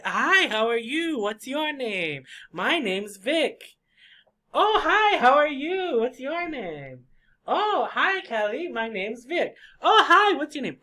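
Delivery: gushy, high-pitched sociable hello; outgoing greeter. Acted. Teacher acts outgoing person meeting people, exaggeratedly friendly and high. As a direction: leap up high on each greeting, then draw out the words. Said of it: "They like to say".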